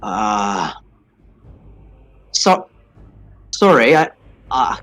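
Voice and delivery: ordinary talk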